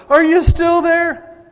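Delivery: high, loud anxious call into the den; slow, worried cry for Daniel; a king afraid for his friend, calling out. Acted. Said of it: "stressed and anxious about him".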